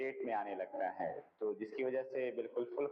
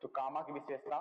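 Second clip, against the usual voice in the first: usual speaking voice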